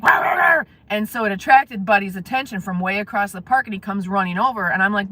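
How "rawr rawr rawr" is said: mock dog growl, higher pitched; growling dog imitation. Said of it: "but the dog, like".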